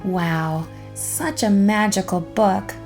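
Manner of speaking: ordinary delivery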